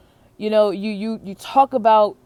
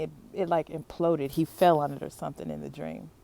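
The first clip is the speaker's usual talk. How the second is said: normal talking voice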